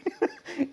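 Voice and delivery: short, high laugh; high chuckle. Real, not acted. Lecturer amused by the angry saint, high chuckle. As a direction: chuckle at the absurdity. Laugh after "how dare he.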